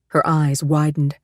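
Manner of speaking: ordinary delivery, nothing marked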